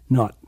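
"not" with the air squeezed out; quick Boston vowel. Shown as a demonstration. Coach shows what he did with Michael, quick squeezed "not". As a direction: make the vowel in "not" short and tight. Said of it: "Make it quick".

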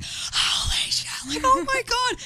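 breathy slow gasp jumping to high pitch; mock panic. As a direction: act out terror, drawn out then high. Acting out terror during the free fall.

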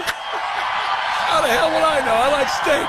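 hammed-up echo of the president's quip; quoting the president. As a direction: savour the line as you echo it. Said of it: "That's the line right there".

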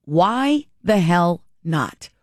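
normal talking voice

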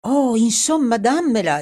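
loud, high, exasperated demand; exclaimed. A narrator's reading. Edward demands the necklace from Dorothy, exclaiming recklessly. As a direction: burst out, throwing caution to the winds. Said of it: "esclamò Edward buttandosi allo sbaraglio".

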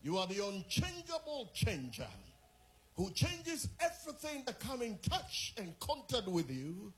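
building fervent prayer, rising to loud, high shouts; rousing prayer from calm to full-throated praise; preaching prayer. Acted. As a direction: pray with mounting fire until it rings out.

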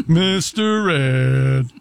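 low, exaggeratedly slow snarky echo; mock snarky. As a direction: imitate a snarky remark, drawn out very slowly. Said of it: "he said that in a snarky manner".

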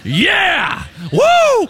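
loud, high triumphant whoop; whooping cheer. Real, not acted. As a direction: cheer yourself like a winning fan. Cheering his name in the Bible.

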